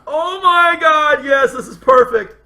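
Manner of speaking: loud, high, drawn-out delight; real excitement. Real, not acted. Writer thrilled by friend's idea, excited outburst. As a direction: burst out, stretching the words high. Thrilled by co-host's acted line.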